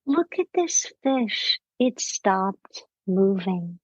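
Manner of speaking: put-on slow, gentle wonder for a child; puzzled children's host, drawn-out words; Mister Rogers. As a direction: talk a child slowly through a discovery. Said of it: "he's talking to a child".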